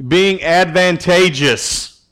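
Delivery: drawn out slowly to get through the long word; slow and careful. Real, not acted. Pastor introducing a long, hard word to his group, slow and careful. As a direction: say "advantageous" slowly, syllable by syllable. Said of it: "I have to say it kinda slow".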